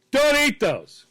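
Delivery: high, loud mock shout; abrupt alarmed yell, retold; sudden shouted warning. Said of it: "hear someone go".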